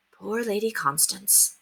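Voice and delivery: soft, slow, full of pity; sympathetic. Acted. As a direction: sigh it with sympathy. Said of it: "with a rush of sympathy".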